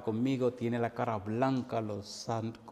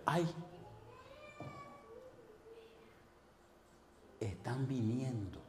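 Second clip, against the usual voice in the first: hushed, slow acted warning; eerie warning; 11 dB quieter, slower than usual. Frightened household's woman warns the visiting preacher, eerie and hushed. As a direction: draw it out softly and ominously.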